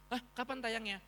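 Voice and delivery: high-pitched eager surprise; antusias girang. Retold or imitated. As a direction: imitate an excited animator asking, voice jumping up. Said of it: "Mereka justru kayak seneng gitu". (kaget girang, nada tinggi)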